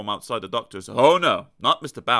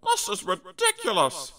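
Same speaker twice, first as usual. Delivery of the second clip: small high character voice. Acted. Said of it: "Mister Bounce thought to himself".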